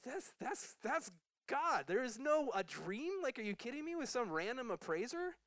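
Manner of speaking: wide-eyed disbelief, voice up high; can you believe it, excited; amazed outburst. Real, not acted. Astonished outburst about the dream.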